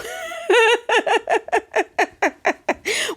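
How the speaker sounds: long, high cackling giggle; real laugh. Real, not acted. Laughing at where taxpayer dollars go, long high giggle. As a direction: laugh helplessly in a high run.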